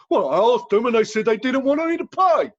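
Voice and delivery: loud, high-pitched protest; mock-outraged self-justification; acted indignant defence. Acting the customer defending himself.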